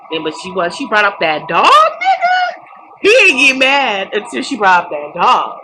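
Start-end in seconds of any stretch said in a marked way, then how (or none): 1.7-5.6 high, loud, worked-up squeal; excited outburst. Real, not acted. Telling viewers the dog part set him off, excited high outburst. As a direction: squeal it, you can't believe she went there.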